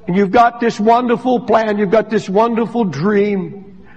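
ordinary talk, nothing marked

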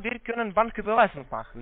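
ordinary talk

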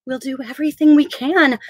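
ordinary delivery

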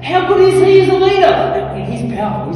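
very high, loud exclamation, easing at the end; impassioned preacher's shout; full-force preaching. Real, not acted. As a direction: cry out to the congregation, then come down.

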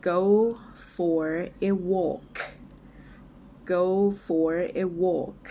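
word-by-word model for repeating, with pauses; slow and clear. Shown as a demonstration. English teacher models a phrase for learners, slow and clear. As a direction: say it slowly, each word apart. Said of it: "ช้าๆ ชัดๆ ไปก่อนนะคะ".

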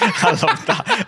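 slow, laughing through the words; can't stop laughing while saying it. Real, not acted. Laughing at the Manx joke.

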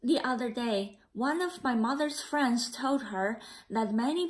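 put-on a gentle read-aloud for children; story narration. A narrator's reading. Teacher reading the class a picture book, gentle narration.

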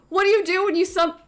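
usual speaking voice

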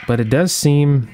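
ordinary talk

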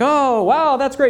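mock wowed. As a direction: sound thrilled by the speed. Retelling his launch reaction.

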